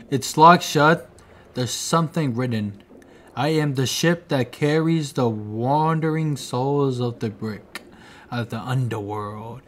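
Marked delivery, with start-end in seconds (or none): 3.4-9.6 reading an inscription. Acted. Player reading the ship inscription aloud, hushed and solemn. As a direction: read it like an old carved warning.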